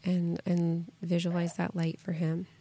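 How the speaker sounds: soft, gentle guiding voice; guided meditation. Acted. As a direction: guide her calmly into a pause. Leads a short visualization.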